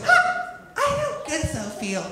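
put-on high hiccup, then slurred queasy girl; hammed-up sick drunk girl. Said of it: "There's the hiccup of death".

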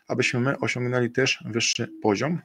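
usual speaking voice

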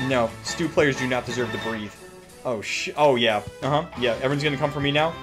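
normal talking voice

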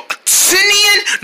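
high, drawn-out "Tinian"; high squeal. Acted. Character naming the monster seen, squealed "Tinian". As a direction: squeal "Tinian" slowly, stretched far above your voice. Frightened character garbles Tin Man.

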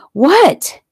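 high, loud single word; exasperated snap. A narrator's reading. Fiancée answers his interruption on the phone, exasperated. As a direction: fire it back impatiently.

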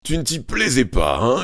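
furious father's yell. A narrator's reading. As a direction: shout it at his son. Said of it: "hurla son père".

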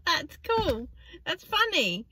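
high, slow mock-cheery friend voice. Mimicking a friend's baffling remark, slow high mock cheer. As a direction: drag it out like a bemused parody. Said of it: "It's like".

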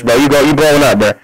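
usual speaking voice

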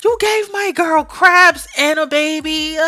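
slow, high, appalled accusation; high-pitched, stretched-out disbelief; incredulous accusation. Real, not acted. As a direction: confront him in drawn-out disbelief. Confronting character over friend's crabs.

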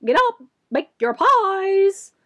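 high, loud rooster call, slow; crowing cock. A narrator's reading. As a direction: crow it out like a cockerel. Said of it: "loudest the cocks cried out".

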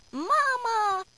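high doll voice calling out; high, drawn-out toy doll cry; talking doll. A narrator's reading. Hidden doll calls out from inside the bread, talking doll voice. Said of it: "Inside is Sophie's talking doll".

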